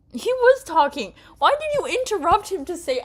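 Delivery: high, loud, fast telling-off; indignant scolding. Real, not acted. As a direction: scold the character hard. Scolds the character.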